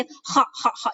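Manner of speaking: "kha" rasped like a cough; short, separate guttural "kha"; throaty kha. Shown as a demonstration. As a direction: scrape each "kha" from the back of the throat. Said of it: "kayak orang lagi batuk".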